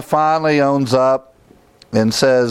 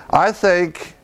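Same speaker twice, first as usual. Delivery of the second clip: ordinary talk, nothing marked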